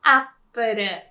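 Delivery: Apple as ap-pu-ru, tapped r; Apple in three Japanese syllables; Japanese pronunciation. Shown as a demonstration. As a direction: show off the cuter Apple, appuru. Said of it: "Which sounds cuter".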